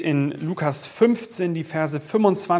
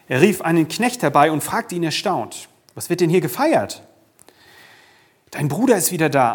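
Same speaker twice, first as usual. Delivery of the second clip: measured, louder reading of the Bible text; scripture reading; 3 dB louder than usual. Acted. Pastor reading Luke to the congregation, solemn reading voice. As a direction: read the parable out to the congregation.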